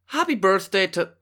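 high-pitched Irish accent attempt. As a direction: launch into a put-on Irish accent. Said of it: "we have Irish".

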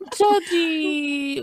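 judgy said through a burst of laughter; laughing. Real, not acted. Laughing while asking, friends joking.

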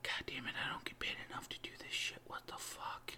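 whispered, fast grumble; barely audible aside to yourself; muttering under breath. Acted. Underpaid producer grumbling to himself, muttered whisper.

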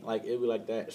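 ordinary delivery

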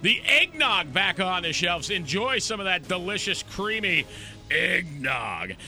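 upbeat announcer, pitch jumping up on key lines; radio sponsor read. Real, not acted. Host reading holiday sponsor spots and thanks, announcer style. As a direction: punch the product names like a local radio host.

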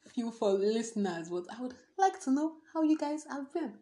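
usual speaking voice

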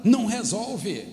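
high, emphatic preacher delivery; preaching at force. Real, not acted. Pastor telling the church everything has its time, forceful preaching. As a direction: drive the moral home to the congregation.

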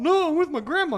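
put-on high, loud tearful kid voice; mock crying child. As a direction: wail it like an upset child. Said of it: "He's crying".

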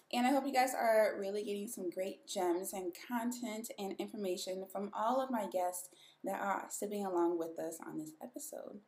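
ordinary delivery

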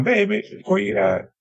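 high sing-song call. Retold or imitated. Biker calling out for his girlfriend, high call. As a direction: call across the boardwalk for her.

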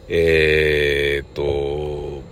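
ordinary talk

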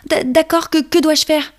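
high, nervous stammering ladybug; anxious little ladybug stammering. Acted.

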